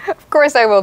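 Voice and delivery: excited. Real, not acted. Eager reply after cheer.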